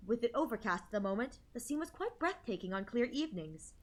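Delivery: soft, even story reading; story narration. A narrator's reading. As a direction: read it gently like a novel. Reads the game narration.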